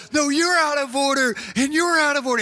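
high, defiant movie-quote yell; Al Pacino outburst. Acted. Preacher re-enacting famous movie line, yelling defiantly. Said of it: "it's that whole".

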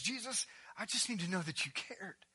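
soft, understated acted hurt; tearful plea. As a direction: plead quietly as the grieving sister. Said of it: "and she's in tears".

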